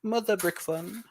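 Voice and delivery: mock posh English accent, high and slow; a playful stab at posh English. Shown as a demonstration. Posh English accent put on for a livestream viewer. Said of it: "I could do it in a posh English accent".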